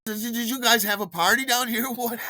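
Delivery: high, stammered mock accusation with a laugh; acted incredulous questioning. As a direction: confront them in high-pitched comic disbelief. Acts confronting the night-shift fellows.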